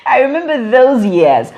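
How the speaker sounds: real laugh through the words. Real, not acted. Broadcaster laughing as she recalls those years.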